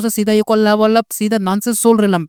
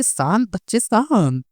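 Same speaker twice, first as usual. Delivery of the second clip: soothing calm; 2 semitones higher, 4 dB quieter, slower than usual. Acted. Sect leader calms angry girl.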